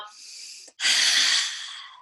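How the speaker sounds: loud unvoiced breath out; big breath in and out. Shown as a demonstration. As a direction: heave a deep breath. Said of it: "So big inhale".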